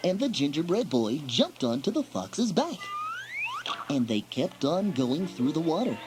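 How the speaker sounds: ordinary delivery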